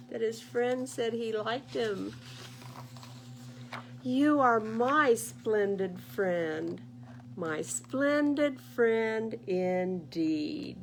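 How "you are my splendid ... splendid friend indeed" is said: very slow, loud heartfelt words; tender bear. Acted. Bear telling goose he's his friend, slow tender. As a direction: draw out each word lovingly. Bear's loving reply.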